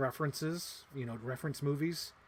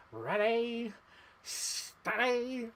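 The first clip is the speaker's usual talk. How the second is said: higher, playful villain quote; imitating Hemsworth; 8 semitones higher, much slower than usual. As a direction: tease it out like the movie villain. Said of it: "Chris Hemsworth is like".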